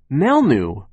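high, loud little outburst; shriek. A narrator's reading. Little girl interrupts the coupon talk, shrieking. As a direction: burst out sharply as the youngest child. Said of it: "Sunny shrieked".

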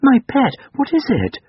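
very high, fussing motherly voice; concerned mother. A narrator's reading. Mother asking her tearful boy what's wrong, high concern. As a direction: fuss over the child in high pitch.

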